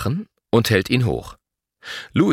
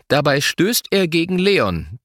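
ordinary delivery

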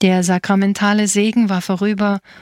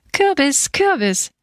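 put-on toddler's cheer; 7 semitones higher than usual. A narrator's reading. Two-year-old sister delighted by the pumpkin, jubilant cheer. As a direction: cheer it in a high little-child voice. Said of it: "jauchzte die zweijährige Elisa".

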